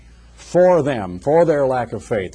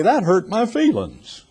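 higher, drawn-out mock wounded complaint; mock hurt; 6 semitones higher than usual. Golfer jokes after a missed putt, mock hurt. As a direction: imitate a jokey hurt-feelings whine, slowly. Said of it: "a statement that he thinks is funny".

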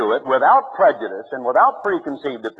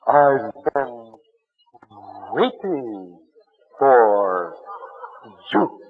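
sultry come-on; 6 semitones lower, much slower than usual. Pretend. Preacher mocking a woman on a gospel show, slow and sultry. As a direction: drag out each word like a teasing singer. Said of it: "the gal that comes on and says".

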